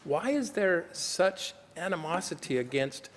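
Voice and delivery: usual speaking voice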